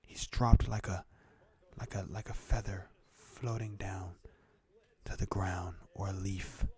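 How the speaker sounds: hushed, mellow, slowed down with long pauses; mock ASMR host. Streamer calling the football game as ASMR, hushed. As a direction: half-whisper the game commentary, calm and unhurried. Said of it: "we're transitioning to the A S M R".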